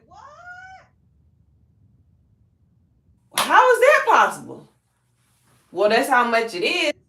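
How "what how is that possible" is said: high, loud astonished cry of "what"; surprised disbelief. Retold or imitated. Jill, told the value, reacting in loud surprise. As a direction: voice jumps up in shock at the news.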